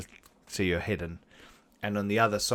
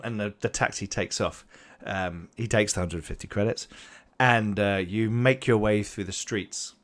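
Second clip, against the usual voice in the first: ordinary delivery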